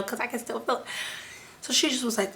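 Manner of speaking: normal talking voice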